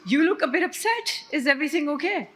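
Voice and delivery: nosy concern. Retold or imitated. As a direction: lean in like a nosy fellow passenger. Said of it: "like any Pakistani who has no idea of personal space".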